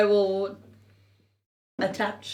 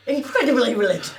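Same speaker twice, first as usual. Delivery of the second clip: laughing; 4 semitones higher, faster than usual. Real, not acted. Laughing at punchline.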